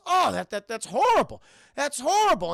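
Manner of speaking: loud mock outrage; exaggerated shocked disbelief; disbelieving. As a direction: act appalled, voice jumping up. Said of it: "Chris Kringle cannot believe this".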